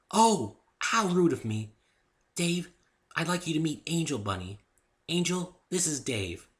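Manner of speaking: high, sudden polite fluster; flustered Fluttershy. Acted. Fluttershy introduces Dave to Angel, high and flustered. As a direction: catch yourself and make introductions.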